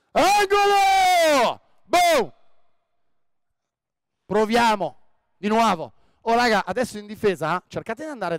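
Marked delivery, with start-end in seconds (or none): 0.1-2.3 high loud long shout of Angolo. Real, not acted. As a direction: yell the call across the court. Coach shouts during the drill. (grido forte e acuto)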